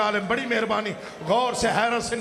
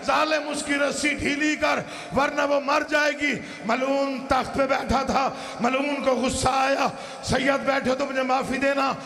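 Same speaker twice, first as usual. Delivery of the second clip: impassioned majlis preaching; 4 semitones higher than usual. Acted. Preacher telling of the exhausted child in Yazid's court, impassioned lament. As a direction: build the grief, stretching key phrases.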